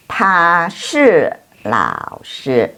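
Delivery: very slow, syllable by syllable; slow model reading. Shown as a demonstration. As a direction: stretch each syllable so students can copy. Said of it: "teacher models Chinese answer".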